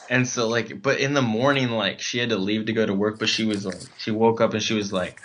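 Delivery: normal talking voice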